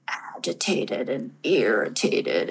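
slow, hushed, drawn-out words; retold agitation, stretched and hushed; agitated whisper. Dwelling on her retold agitation.

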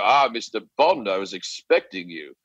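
low, menacing villain voice; Bond villain. Acted. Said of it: "you can also be a Bond villain".

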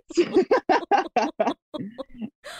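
high squealing laugh; laughing hard. Real, not acted. Laughing at own story.

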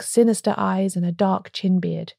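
usual speaking voice

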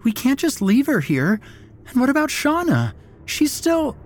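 very high, frantic protest; distressed pleading. A narrator's reading. Mara pleads for her two friends, distressed. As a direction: plead until cut off.

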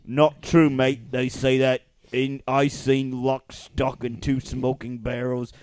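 Cockney mate defending himself; staying in London Cockney. Shown as a demonstration. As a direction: argue back in Cockney. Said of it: "London Cockney".

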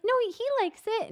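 high, sing-song coaxing; coaxing mom. Retold or imitated. Mom coaxing son, retold.

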